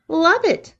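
high, bright delighted cheer. Acted. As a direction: cheer it out with glee. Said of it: "cheered Claire".

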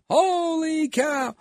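very high, slow, stretched-out exclamation; hammed-up broadcaster's cry of amazement; Phil Rizzuto. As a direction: draw it out high, imitating a sportscaster's call. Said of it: "this is like Phil Rizzuto".

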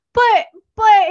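high, loud imitation of pleading; pleading protest. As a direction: burst in with an acted objection. Protesting husband's no-sympathy answer.

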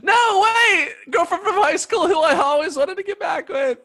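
high, loud pleading call; desperate call. Acted. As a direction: call her back desperately. Calling after departing girlfriend.

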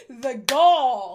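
loud gasp then outraged; gasping mock outrage. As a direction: play it scandalized.

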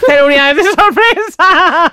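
laughing. Real, not acted. Laughing at her own story.

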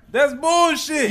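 slow, loud call of bullshit; loud dismissal. Real, not acted. Rejecting his cohost's logic, slow and loud. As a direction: drag it out loud. Arguing back.

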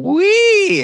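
high squeaky silly voice. Acted. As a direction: pipe it up high and slow.

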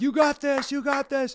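high-pitched mock cheering; choir cheer. Choir members cheering on the soloist, high cheering. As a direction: call it out as high, eager encouragement.

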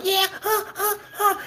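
put-on very high, whiny kid's voice; mock bratty kid. Five-year-old at dinner, very high and whiny. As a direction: imitate a wild little boy. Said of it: "five-year-old was like".

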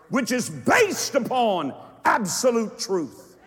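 high, loud declamatory shout; full-force preaching. Real, not acted. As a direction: proclaim it to a roused congregation in driving phrases.

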